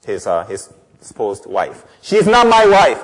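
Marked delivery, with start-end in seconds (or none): 2.0-3.0 loud, raised-pitch divine rebuke; forceful, indignant scripture reading; angry God. Acted. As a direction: thunder the scripture like a wronged husband. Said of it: "Why is God so angry".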